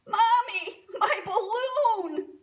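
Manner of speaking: high, loud wail for mommy; hammed-up child's bawl, drawn out at the end; little girl crying. A narrator's reading. Little girl cries to mommy over her balloon, wailing. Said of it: "he heard a little girl crying".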